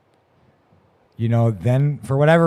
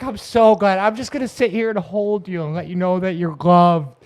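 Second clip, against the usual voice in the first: comforting lady; 7 semitones higher, 4 dB louder than usual. Retold or imitated.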